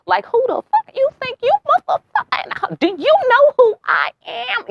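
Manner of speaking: very high put-on voice, indignant who-do-you-think-you-are; mock diva outrage. Breaking the glass on her ego, high mock diva outrage. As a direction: play an offended diva demanding respect.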